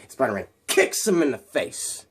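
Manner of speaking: plain reading voice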